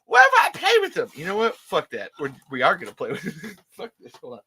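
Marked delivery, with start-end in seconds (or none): 0.1-1.1 put-on high, loud childlike squeal; mock-pleading excited squeak; giddy inner voice. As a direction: blurt it like an eager kid. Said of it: "And I'm just like".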